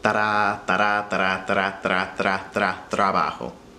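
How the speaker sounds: ta-da sped up until it becomes tra, then trabajo; speeding up into rolled r. Shown as a demonstration. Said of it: "the faster you say it".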